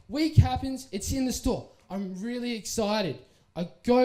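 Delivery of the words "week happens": ordinary talk, nothing marked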